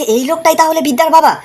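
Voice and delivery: high-pitched startled question; retold shock. Kashyap seeing who Bidya's father is, retold shock. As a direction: voice someone taken aback, pitch jumping up. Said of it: "দেখে পুরো চমকে গেছে".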